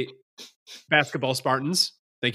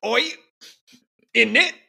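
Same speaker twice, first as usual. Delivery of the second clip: bad Cockney; 10 semitones higher than usual. Friend joining the bit as a Cockney chimney sweep. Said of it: "This is truly terrible".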